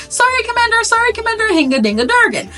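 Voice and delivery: high-pitched pretend wailing; comic high cries for mercy; mock screaming. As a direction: squeal apologies without much volume. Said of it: "the screaming is saying".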